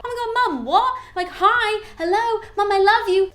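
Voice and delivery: mock overjoyed child, high and loud; gushing son. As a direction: squeal like a thrilled son seeing his mum. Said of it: "response that we would assume".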